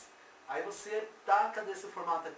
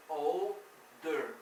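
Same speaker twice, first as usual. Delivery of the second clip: put-on "older" as "ol", pause, "der"; deliberate two-piece "older" for learners; split syllables. Shown as a demonstration. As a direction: break "older" slowly in two. Teacher recaps word in parts.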